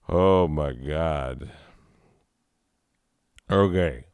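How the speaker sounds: ordinary talk, nothing marked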